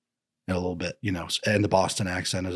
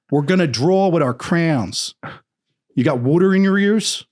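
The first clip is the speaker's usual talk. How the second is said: put-on Philly accent; 8 semitones higher, 7 dB louder than usual. Retelling schoolmates' talk for laughs, Philly accent. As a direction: heckle in exaggerated Philadelphia vowels, loud. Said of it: "I remember being a little boy in elementary school".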